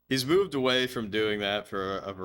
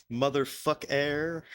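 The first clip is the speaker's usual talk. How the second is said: slow, higher troll catchphrase voice; Esteban Winsmore; 5 semitones higher than usual. Retold or imitated. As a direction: imitate the calm troll, drawing each word out. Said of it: "one of the things that he would say".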